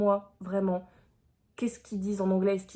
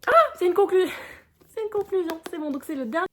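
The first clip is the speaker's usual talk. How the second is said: high loud sudden ah of discovery; light up at the news; surprised realization; 9 semitones higher, 7 dB louder than usual. Real, not acted. Vlogger realizing the book is the final volume, surprised.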